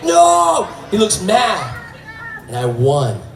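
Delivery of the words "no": high wailed refusal; mock high-pitched yell; protesting old janitor. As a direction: imitate João crying out as he's wheeled off. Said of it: "He's like".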